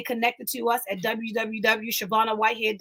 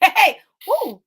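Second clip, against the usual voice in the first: a sudden high, loud laughing woo; laughing whoop; 7 semitones higher, 7 dB louder than usual. Real, not acted. Preacher whoops with laughter at her own point, excited. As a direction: burst out in joyful laughter.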